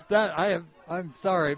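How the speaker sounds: ordinary talk, nothing marked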